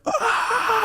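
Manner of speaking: high-pitched effort cry; imitated squeal of exertion; straining yell. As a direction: strain hard against a bear hug. Acts straining to break free.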